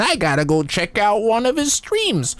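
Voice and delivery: high, mock-baffled onlooker voice; incredulous passerby. Imagined viewer finding his stream, high and incredulous. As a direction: play a gawking stranger, pitched high.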